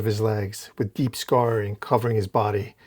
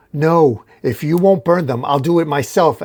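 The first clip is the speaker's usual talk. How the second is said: raised, higher, louder refusal; desperate outburst; 4 semitones higher, 6 dB louder than usual. Retold or imitated. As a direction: insist in a raised voice.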